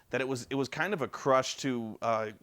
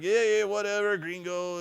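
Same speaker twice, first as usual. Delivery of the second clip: high mock Mexican accent brushing him off; dismissive bandit; 7 semitones higher than usual. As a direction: wave him away lazily. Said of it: "he's like".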